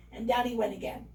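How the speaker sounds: ordinary delivery, nothing marked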